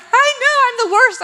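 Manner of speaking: high-pitched, loud hammed-up wail; imitating a crying confession; mock tearful outburst. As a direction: blurt it out like floodgates opening. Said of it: "The floodgates opened".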